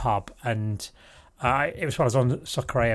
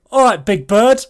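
loud, brash call across the bar; 8 semitones higher, 10 dB louder than usual. Retold or imitated. Bloke calls to the tall girl, loud and brash. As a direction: bellow the line across the bar.

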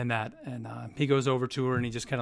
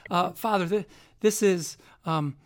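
higher, hurried, flustered apology; flustered young woman; 6 semitones higher, 3 dB louder, slower than usual. Acted. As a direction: stumble over the introduction nervously. Flustered NPC introduces friend.

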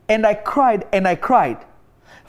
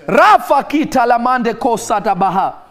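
louder, fervent prayer in tongues; speaking in tongues; 4 dB louder than usual. Acted. Preacher breaking into tongues before his online flock, fervent. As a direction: pour it out as ecstatic prayer.

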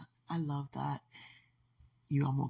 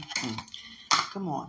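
usual speaking voice

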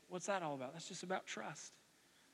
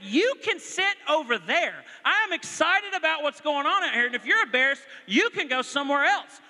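louder, higher excited telling-off; fired up at the game, no apologies; excited fan; 9 semitones higher, 10 dB louder than usual. Retold or imitated. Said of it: "I said".

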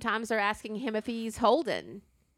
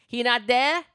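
louder mock demand in a rough Jamaican-like accent; vaguely Jamaican accent; 3 semitones higher, 6 dB louder, slower than usual. Caller ignores the whole story, Jamaican-like accent. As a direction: imitate an insistent caller with a loosely Jamaican accent. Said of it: "sounds kinda like that".

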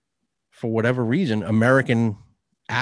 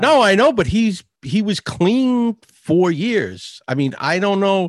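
high, louder protest; insistent rebuttal; 7 semitones higher, 4 dB louder, slower than usual. Real, not acted. Friend rebutting that he was an addict, insistent. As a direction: push back hard, stretching out the point. Heated rebuttal to co-host's explanation.

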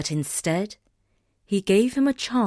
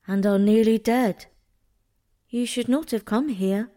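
cool; 3 semitones higher than usual. A narrator's reading. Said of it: "she said coolly".

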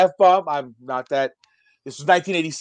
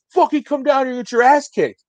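higher-pitched acted challenge from the street; mock threat; 7 semitones higher than usual. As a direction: call up a mildly hammed-up threat. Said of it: "he looks at me like".

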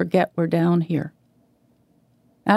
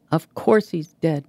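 usual speaking voice

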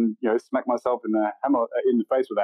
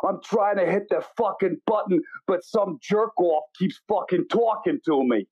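New York cabbie; 7 semitones higher than usual. Retold or imitated. New York bit continues.

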